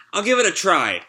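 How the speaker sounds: put-on child voice. Child repeats promise.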